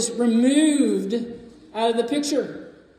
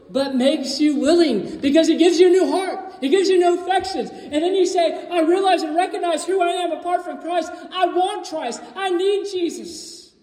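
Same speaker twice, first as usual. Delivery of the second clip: building fervour; 7 semitones higher than usual. Real, not acted. Preacher rising in fervour.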